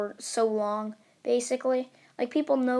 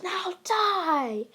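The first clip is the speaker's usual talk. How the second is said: high-pitched battle cry; villain's attack cry; 7 semitones higher than usual. Acted. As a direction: pitch it up and draw out the threat.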